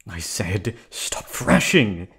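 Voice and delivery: calm breaks into a shout; sudden yell. Acted. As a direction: snap the order at the captive. Yells at captive.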